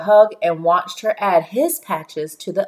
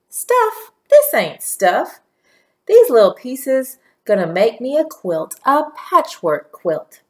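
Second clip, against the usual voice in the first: grandma voice; 5 semitones higher, 4 dB louder than usual. A narrator's reading. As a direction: voice Grandma, emphatic and proud.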